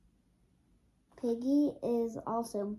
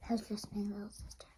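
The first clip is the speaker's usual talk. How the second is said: whispered aside; 8 dB quieter, much faster than usual. Real, not acted. Aside to listeners.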